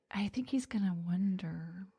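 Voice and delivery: hushed voiced-over thought; whisper. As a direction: whisper it like a secret. Imagining a date's thoughts, whispered.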